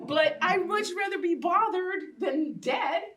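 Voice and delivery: high, slow, laughing and pained; half-laughing, half-crying rueful aside. Real, not acted. Rueful laugh about neighbors' reaction.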